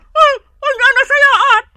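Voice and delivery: high, loud and slow indignant cry; indignant outburst. Acted. Toothless mother snapping back at her daughter, loud and outraged. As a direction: throw the question back, outraged. Indignant retort about dentures.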